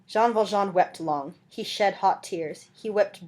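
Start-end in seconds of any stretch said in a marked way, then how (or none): none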